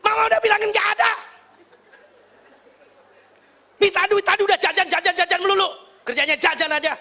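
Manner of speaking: loud, high, rapid-fire mock tirade; scolding mother. Mother berates child for always buying snacks, hammed-up angry scolding. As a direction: rant like an exasperated mum. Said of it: "Ibu-ibu ada yang suka ngomong gitu".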